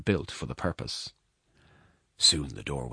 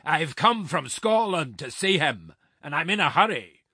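giant's growl; 12 semitones higher, 5 dB louder than usual. A narrator's reading. Giant brushing off the woman's question, growling loudly. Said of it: "growled the giant".

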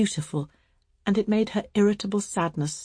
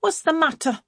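high, sharp call; sharp, high-pitched demand; sharp cry; 11 semitones higher, 4 dB louder than usual. A narrator's reading. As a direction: call sharply from the next room. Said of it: "cried a sharp voice from the other room".

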